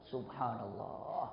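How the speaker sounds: slow, low, very soft; hushed awe. Real, not acted. Preacher marvelling after the story, hushed. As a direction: draw it out softly in wonder.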